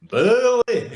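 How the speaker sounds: high, loud hammed-up northern call; comic northern accent shout. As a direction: holler it in a put-on northern English accent. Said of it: "I shout and I say".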